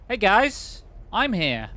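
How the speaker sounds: high, loud greeting to the group; calling out. Pretend. Calls out to game characters.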